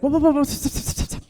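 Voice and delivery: rapid repeated halt, fading to voiceless; putting brakes on. Retold or imitated. Comic imitates date halting things mid-hookup, mock urgent stopping. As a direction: imitate a man hurriedly calling a stop. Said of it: "he put the brakes on".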